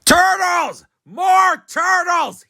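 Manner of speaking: high, loud bray, slow on the second shout; bellowing. A narrator's reading. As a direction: bellow like a greedy king. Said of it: "he bellowed and brayed".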